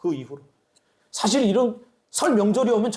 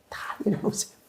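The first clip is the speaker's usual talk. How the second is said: softly whispered 내려놓으세요, breathy and hushed; whispered plea; 3 semitones higher, 6 dB quieter than usual. Real, not acted. Pastor whispering to the congregation, urging them to let go. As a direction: hush it down to a whisper. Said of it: "repeated as whisper".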